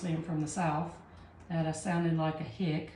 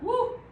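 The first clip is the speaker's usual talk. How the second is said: short high-pitched "woo"; relieved whoop. Real, not acted. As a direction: let out a quick whoop after careful work. Tense careful stencil step finished.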